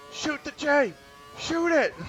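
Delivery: high, loud mock yell across the field; a hammed-up yell from the stands; retold yell. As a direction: shout it like a fan at a kid on the pitch. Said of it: "Adam just yells out".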